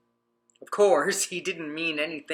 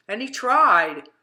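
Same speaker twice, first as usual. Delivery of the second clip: third tried slow and louder; building emphasis; 4 semitones higher, 6 dB louder than usual. A narrator's reading. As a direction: lean hard on the last tried.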